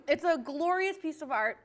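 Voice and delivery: usual speaking voice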